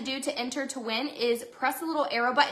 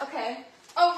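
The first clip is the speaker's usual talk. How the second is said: ordinary talk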